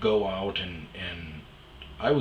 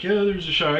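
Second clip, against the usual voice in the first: host; 10 semitones higher, 7 dB louder, faster than usual. Retold or imitated. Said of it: "And the host is like".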